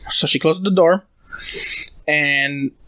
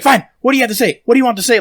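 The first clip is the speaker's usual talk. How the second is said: imitating an angry mother's higher yell; retold screaming; 7 semitones higher, faster than usual. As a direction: mimic her screaming it at him. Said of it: "Like, screaming".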